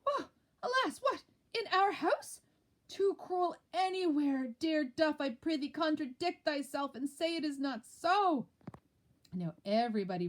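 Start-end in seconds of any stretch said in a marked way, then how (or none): 0.0-8.5 high, loud mock dismay; feigned shock. Lady Macbeth acting shocked at the murder. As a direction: fake the horror. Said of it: "She does play the part well".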